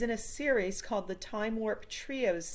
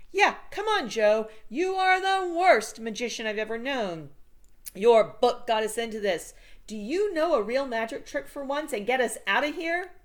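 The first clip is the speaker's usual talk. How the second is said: put-on louder, higher exasperated kid; annoyed Fred; 5 semitones higher, 7 dB louder than usual. A narrator's reading. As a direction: scold the useless magician. Fred angrily urges Joe.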